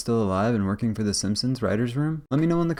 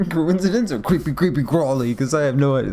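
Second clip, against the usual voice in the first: playful, louder mock echo of the storyteller; 3 semitones higher, 5 dB louder than usual. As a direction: parrot her closing line back, amused. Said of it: "That was great".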